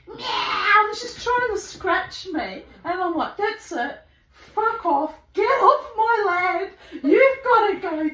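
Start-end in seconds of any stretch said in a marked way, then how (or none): 0.1-0.9 high, louder cat cry; imitated cat meow. Pussycat trying to scratch her, imitated high meow. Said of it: "And she's like". 3.4-8.1 high, building telling-off; mock-furious orders, getting louder; mock anger. Shooing the cat while the neighbour watches, playfully angry. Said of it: "he knows I'm not really mad at her".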